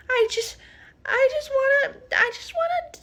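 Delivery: a rising, frightened squeak; panicked squeak. Retold or imitated. Stacy panicking, very high.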